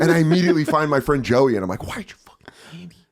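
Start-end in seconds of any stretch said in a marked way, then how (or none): 1.9-3.0 hushed, accusing whisper; re-enacted secretive scolding; urgent whisper. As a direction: whisper it furiously to a friend. Hissing at friend at party.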